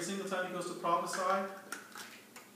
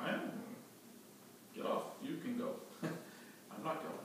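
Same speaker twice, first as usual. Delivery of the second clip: hushed, laughing donkey refusing to budge; breathy whispered refusal, giggling through it; stubborn donkey voice; 12 dB quieter than usual. Acted. Stubborn donkey refusing its rider, hushed and laughing.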